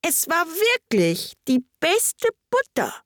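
high, slow, helpless insistence; meek March Hare. A narrator's reading. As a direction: repeat the excuse pitifully in a high voice. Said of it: "als seine erste Bemerkung".

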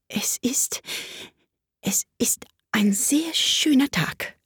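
halting, whispery small talk; shy whisper with hesitant pauses; timid. A narrator's reading. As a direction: venture it shyly, slow and hushed. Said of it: "sagte eine schüchterne Stimme".

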